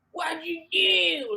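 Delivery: high, drawn-out mock yell in a Baltimore accent. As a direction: stretch it out, imitating someone yelling at somebody. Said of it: "somebody yelling at somebody like".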